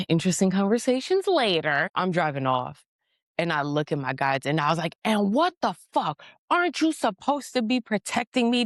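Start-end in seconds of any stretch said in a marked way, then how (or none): 5.1-8.7 high-pitched, aggrieved protest; indignant complaint. Retold or imitated. Angry driver blaming the guides for not protecting, indignant complaint. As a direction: re-enact whining outrage at being let down.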